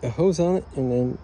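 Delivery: talking through hiccups; words jolted by hiccups. Real, not acted. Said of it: "I have hiccups".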